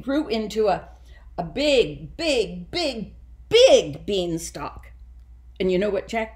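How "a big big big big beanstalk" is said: each big louder, last drawn out slow; big emphasized. A narrator's reading. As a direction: stretch out the final big, big beanstalk. Stressing the huge beanstalk.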